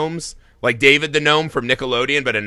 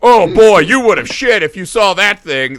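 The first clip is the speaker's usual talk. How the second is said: louder, lightly hammed-up horror storyteller; mock Lovecraft narrator; 5 semitones higher, 6 dB louder than usual. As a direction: spook the listener in jest. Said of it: "That's every description".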